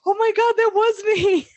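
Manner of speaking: high, laughing "oh my God, that was me"; laughing shock. Retold or imitated. To the man she forgot, laughing in horror. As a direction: squeal it through laughter, then calm down. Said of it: "And I go".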